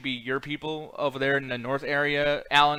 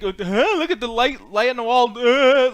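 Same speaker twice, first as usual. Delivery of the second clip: lightly voiced dim-witted gawker, louder and higher; a hint of slow-witted mockery, pitched up; mock dumb gawping; 6 semitones higher, 7 dB louder, slower than usual. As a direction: imitate someone dumbly gawping at a light. Mimics someone gawping at a light.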